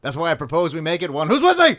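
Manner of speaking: hammed-up call to revolt; mock rallying cry. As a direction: swell to a shouted appeal to the crowd. Acted rallying speech as Bill.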